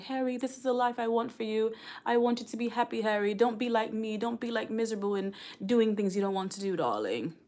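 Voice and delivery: a mother's gentle British accent, lightly put on. A mother telling her young son Harry to be happy, in a mock British accent. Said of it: "don't laugh at my accent".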